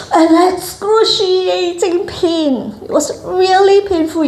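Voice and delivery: high, drawn-out mock wail of pain; hammed-up agony, slow and high; acted agony. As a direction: stretch the words out high as if in pain. Acting out remembered breastfeeding pain.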